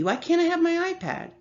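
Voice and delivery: put-on high, complaining little girl; whiny child demand. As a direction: whine for the iPad like a sulky kid. Little sister demanding her iPad.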